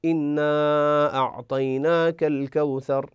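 إنا أعطيناك الكوثر with the alif of إنا held four counts, slow; four-count madd. Shown as a demonstration. Teacher demonstrating a longer madd, slow recitation. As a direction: stretch إنا to four beats. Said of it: "أربع حركات".

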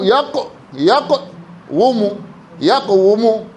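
"يقوم" broken slowly as yaq-wu-mu; underlying form. Shown as a demonstration. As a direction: sound the waw as a consonant in "يقوم": yaq-WU-mu. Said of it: "والأصل هكذا". (نطق "يقوم" على الأصل: يَقْوُمُ)

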